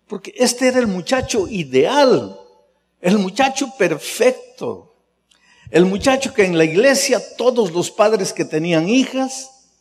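fervent pulpit build; full preaching. Acted. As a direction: lift the room with the praise.

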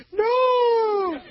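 high, loud, drawn-out crying no; mock wailing no. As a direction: wail it like a heartbroken sister. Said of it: "My sister's going".

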